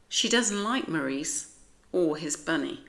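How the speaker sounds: usual speaking voice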